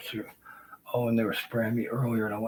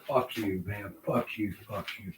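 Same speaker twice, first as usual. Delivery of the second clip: ordinary talk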